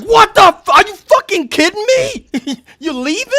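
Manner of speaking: high, loud incredulous yelp; shocked disbelief. Retold or imitated. Narrator in disbelief as the cop drives off, high and loud. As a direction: shriek in disbelief.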